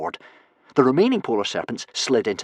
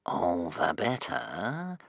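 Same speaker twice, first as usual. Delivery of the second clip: very slow, low, soft serpent voice; whining serpent; 5 semitones lower, 10 dB quieter, much slower than usual. A narrator's reading. Serpent whines at trapped Hiccup, slow and menacing. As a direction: drag it out like a sly beast. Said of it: "whined the nearest polar serpent".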